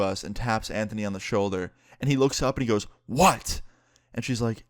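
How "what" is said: mock angry snap, higher and louder; angry raised voice. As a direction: act out an irritated teen raising his voice. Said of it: "never raise your voice like that".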